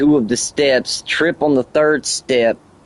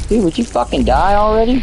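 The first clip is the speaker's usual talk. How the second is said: shouted high, fed up with the enemy; exasperated gamer outburst; frustrated yell at the game; 11 semitones higher than usual. Real, not acted. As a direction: snap at the screen that won't let him win. Yelling at a game that glitches.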